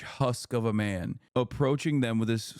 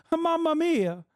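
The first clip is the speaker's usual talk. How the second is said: much higher hammed-up Italian accent; over-the-top Italian "Mamma mia" voice; mock Italian; 13 semitones higher than usual. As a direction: squeal it like a cartoon Italian. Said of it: "Now I'm saying".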